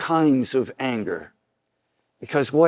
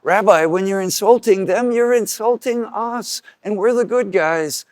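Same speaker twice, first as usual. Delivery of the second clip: higher, indignant protest; offended lawyer; 6 semitones higher, 3 dB louder than usual. Retold or imitated. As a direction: sound wounded and self-important. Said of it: "one of the lawyers comes up and says".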